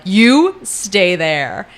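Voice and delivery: slow, emphatic order with each word drawn out; firm command. Retold or imitated. Male lead points at her, commanding.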